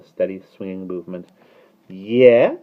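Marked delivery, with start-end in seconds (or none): none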